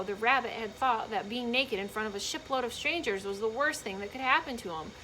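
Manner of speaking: ordinary delivery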